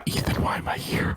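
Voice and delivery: unvoiced aside to a friend; whisper. Real, not acted. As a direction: whisper it under your breath.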